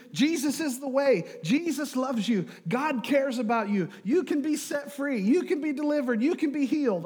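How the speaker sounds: high, punchy preaching cries; earnest preacher. Retold or imitated. Missionary preaching to a flat crowd, high and emphatic. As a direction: preach it out in short, rising-and-falling declarations. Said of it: "he's preaching".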